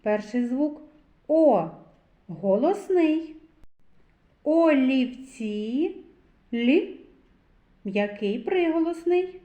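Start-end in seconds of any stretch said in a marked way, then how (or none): 4.4-6.0 олівці slowly stretched, rising at the end; drawn-out sounding-out. Shown as a demonstration. Teacher leading children to the sound л, slowly drawn out. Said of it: "звуковий аналіз слова олівці".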